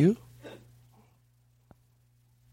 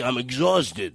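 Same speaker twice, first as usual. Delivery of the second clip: ordinary talk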